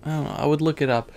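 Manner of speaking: ordinary delivery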